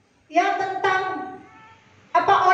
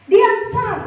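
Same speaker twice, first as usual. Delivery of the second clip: higher, louder acted hush; husband shushing wife; 4 semitones higher, 5 dB louder than usual. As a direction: cut her off as the husband. Husband hushing his angry wife. (suami menyuruh istrinya diam)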